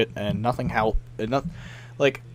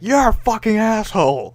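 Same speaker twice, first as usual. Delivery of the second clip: higher, jeering mock insult; 7 semitones higher, 3 dB louder than usual. Imitating players insulting him. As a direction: spit it like a rude player. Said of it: "they're like".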